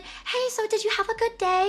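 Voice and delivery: high, cheery question; bubbly and cheery. Retold or imitated. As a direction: play the nice bubbly host. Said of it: "she's so bubbly and cheery and nice".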